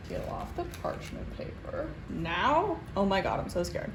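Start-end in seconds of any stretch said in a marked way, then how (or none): 0.1-1.9 tense whisper. Real, not acted. Peeling paper, tense.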